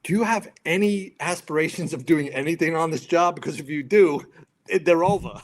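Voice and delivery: loud mock dressing-down; captain's threat. Captain threatening a firefighter's career, loud warning. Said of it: "And he was like".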